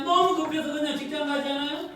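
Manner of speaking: ordinary delivery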